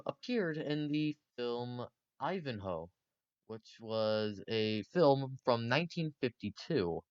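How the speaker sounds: ordinary delivery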